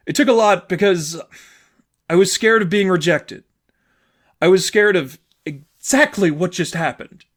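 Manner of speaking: put-on a hurt girlfriend confessing her fear; tearful girlfriend. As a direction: hold back tears while explaining. Sadie's voice, tearful.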